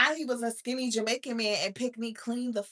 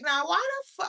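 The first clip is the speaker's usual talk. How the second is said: shocked disbelief; 9 semitones higher, faster than usual. Retold or imitated. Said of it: "I'm like".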